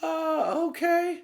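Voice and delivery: high falsetto hesitation; squeaky, unsure lady voice; high woman voice. Retold or imitated. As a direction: falsetto uncertain "okay". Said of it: "And she's like".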